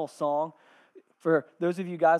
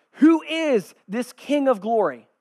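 loud, high, drawn-out question; gatekeepers' response; 8 semitones higher, 13 dB louder, slower than usual. Acted. People at the gates answering the herald, loud questioning call. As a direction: call back the question slowly and forcefully. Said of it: "And they respond".

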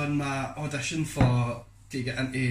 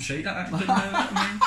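laughing; 9 semitones higher, 4 dB louder than usual. Real, not acted. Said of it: "How do you do a Liverpool accent?".

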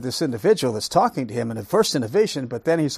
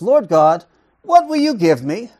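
loud, raised, acted demand; 6 semitones higher, 8 dB louder than usual. Preacher acting Abraham questioning God, loudly demanding. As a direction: challenge God as a bold Abraham.

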